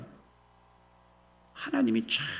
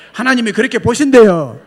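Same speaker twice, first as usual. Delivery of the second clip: much louder, stress on 보신대요; building to a shout; 5 semitones higher, 12 dB louder than usual. Real, not acted. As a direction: shout 보신대요 to the back row. Third take loudest. (크게 외치며)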